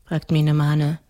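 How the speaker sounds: usual speaking voice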